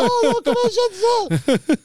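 high, loud, squealing protest; panicked shriek. Retold or imitated. Naked man to the chambermaid, high panicked shriek. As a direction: wail it in a shrieking falsetto, drawing out the end. Retelling panic when caught showering.

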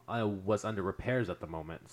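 normal talking voice

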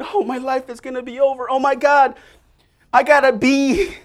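high, loud panicky spiral; anxious panic. Retold or imitated. Preacher imitating a panicking student, high and fretful. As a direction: spiral into mock despair, half laughing.

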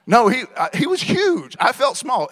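loud, high mock outrage; exasperated protest. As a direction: protest it like she's lost her mind. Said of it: "I'm like".

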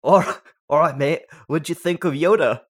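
put-on lightly done Manchester accent; a touch of Manchester in it. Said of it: "I'm gonna do a Manchester accent".

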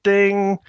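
high sung ding; imitated chime. Mimicking the film's opening crawl, high chime.

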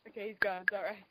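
usual speaking voice

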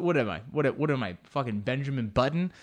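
normal talking voice

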